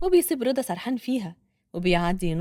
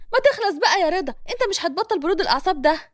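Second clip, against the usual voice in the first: impatient woman; 6 semitones higher, 4 dB louder, faster than usual. Acted. Hana losing patience with Reda.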